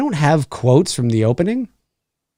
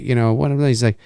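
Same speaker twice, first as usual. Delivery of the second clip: normal talking voice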